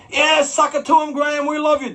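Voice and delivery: high, loud mock cheer from the crowd; cheering fan. Audience member cheering the promoter, high mock cheer. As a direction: whoop it like an excited fan.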